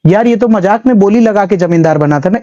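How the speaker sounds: ordinary delivery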